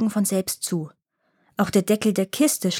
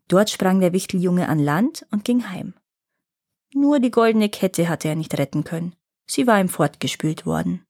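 ordinary delivery

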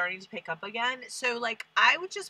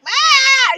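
high, loud squawked note; mock bad singing squawk; 15 dB louder than usual. Coach mocking her own singing, high loud squawk. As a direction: belt a terrible note on purpose. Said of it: "I'm like".

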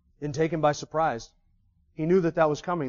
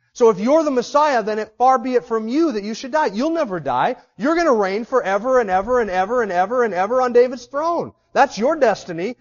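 louder, raised, pleading disbelief; Peter's insistent protest; 5 semitones higher, 6 dB louder than usual. Retold or imitated. Peter insists to Jesus his Messiah cannot die, louder and pleading. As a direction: push back hard, he can't die.

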